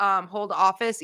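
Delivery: normal talking voice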